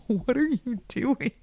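slow, high, drawn-out disbelief; playfully baffled, high-pitched question; mock bewilderment. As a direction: stretch it out high, puzzled and amused. Mock reaction to his odd smile.

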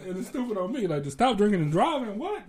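high, loud teasing with a laugh; mock him, pitch shooting up; mocking laughter. Teasing the friend about drinking and driving, laughing loudly. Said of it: "I just kept saying".

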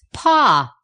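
loud, high burst of disgust. A narrator's reading. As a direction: spit it out like horrid medicine. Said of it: "with a face such as you put on when you take a horrid".

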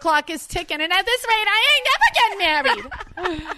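high, loud Jersey whine; hammed-up Jersey accent climbing to a peak. Fiancée reprising marriage complaint, indignant Jersey accent. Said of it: "I'll do it in a Jersey accent".

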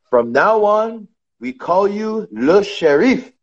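put-on thick French accent, slow; owner's French accent. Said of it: "in a big, thick French accent".

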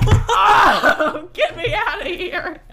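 high, loud shout breaking into laughter; mock freak-out. Daughter cornered by dad's question, laughing high-pitched shout. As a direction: cry out in comic desperation.

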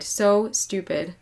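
slow and aching, every word dragged; slow, anguished repeat. Real, not acted. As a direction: let it sink slowly. Slowed repeat of self-reproach.

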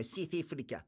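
South Africa with clipped South African vowels, "siff-ricka"; lightly put-on South African accent on "South Africa". Shown as a demonstration. New Zealander teasing the South African accent to his co-host. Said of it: "we wanna go into that South African accent".

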